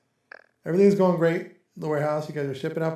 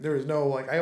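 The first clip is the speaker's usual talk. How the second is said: ordinary talk, nothing marked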